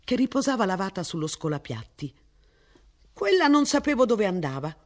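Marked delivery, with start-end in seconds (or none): 3.1-4.8 higher, sheepish apology; sorry for the misplaced dish; apologetic. A narrator's reading. Martin excusing himself to missus Ransom, apologetic. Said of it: "spiegò Martin in tono di scusa".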